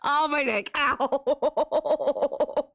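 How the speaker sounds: high ow breaking into giggles; pained laughter. Real, not acted. Streamer's neck hurts from laughing, high pained giggle. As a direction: whine in pain while still laughing.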